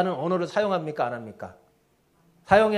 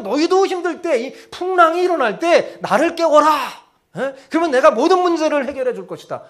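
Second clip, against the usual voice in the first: loud, high, emphatic promise; commanding Lord; 9 semitones higher, 8 dB louder than usual. Acted. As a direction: declare it forcefully as master to followers. Imagined Lord's praise, acted. (주님이 제자들에게 힘주어 크게 선포하듯)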